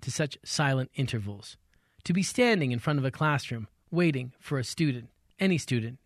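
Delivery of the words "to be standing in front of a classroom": ordinary delivery, nothing marked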